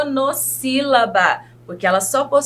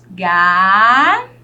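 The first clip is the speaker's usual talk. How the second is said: "Galinha" broken into ga, li, nha with long gaps; slow, loud syllable count-out of "Galinha"; 4 semitones lower, 5 dB louder, slower than usual. Shown as a demonstration. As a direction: hold each syllable of "Galinha" on its own, pausing between. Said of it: "Quantas sílabas eu tenho nessa palavra".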